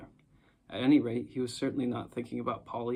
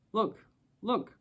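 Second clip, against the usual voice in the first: much higher, eager cry; excited cry; 9 semitones higher than usual. A narrator's reading. Diggory spotting the ring, crying out excitedly. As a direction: point it out with a sudden excited cry. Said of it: "cried Diggory".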